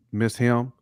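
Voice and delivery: normal talking voice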